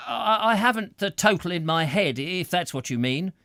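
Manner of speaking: sulkily. A narrator's reading. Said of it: "rather sulkily".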